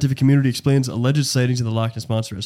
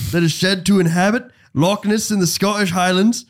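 hammed-up Scottish accent, pitched up; jokey Scottish accent for the Nessie entry; 6 semitones higher than usual. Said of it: "do it in the accent".

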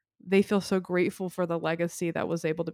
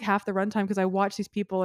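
ordinary talk

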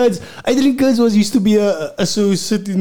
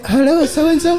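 put-on girl on the phone; 8 semitones higher than usual. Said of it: "She say".

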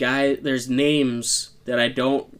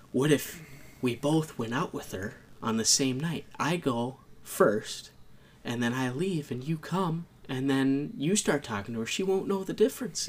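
imitated whispering; 3 semitones higher, 7 dB quieter than usual. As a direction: softly mimic someone plotting in secret. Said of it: "like, whispering".

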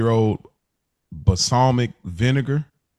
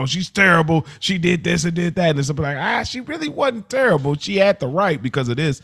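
higher, lightly voiced fans' opinions; mimicking viewers; 7 semitones higher than usual. Host voicing viewers' takes on Carmella, lightly voiced higher. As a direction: imitate viewers arguing.

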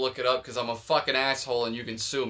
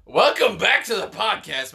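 slow, loud, raised announcer welcome; podcast host intro; 6 semitones higher, 7 dB louder than usual. Acted. Host greeting listeners at the start, hyped announcer voice. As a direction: boom out the show opening slowly.

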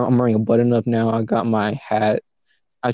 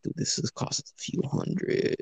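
whispered under the breath, almost no voice; hushed whisper; 12 dB quieter than usual. Retold or imitated. Quoting her quietly about the pricey watch, whispered. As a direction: breathe it so nobody hears.

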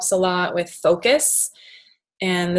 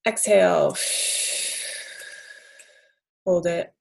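guided breathing. Shown as a demonstration. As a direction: call each breath step calmly. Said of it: "let's just try that one as well".

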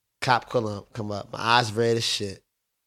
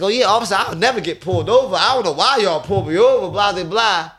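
louder, higher, cocky trash talk to the officer; swaggering taunt at the cop; cocky mock bragging; 6 semitones higher, 5 dB louder than usual. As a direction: show off to the cop like you got away clean. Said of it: "start to get cocky and talk a bunch of shit".

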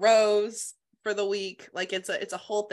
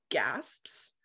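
breathy whisper; 6 semitones lower, 4 dB quieter than usual. Real, not acted. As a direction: whisper the gasp. Ladies gasp in shock.

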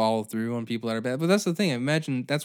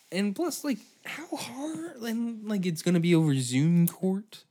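squeaky disbelief; 9 semitones higher than usual. Real, not acted. Disbelief at the Zoom court.

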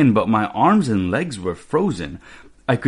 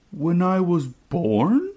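slow, higher question rising on the last word; thinking hard; 8 semitones higher, much slower than usual. A narrator's reading. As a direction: ask it slowly, as if searching your memory. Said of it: "thinking hard".

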